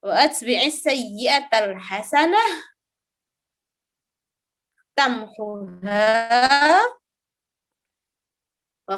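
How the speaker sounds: slow, drawn-out Arabic recitation with long pauses; hadith recitation. Real, not acted. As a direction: recite phrase by phrase for learners to follow.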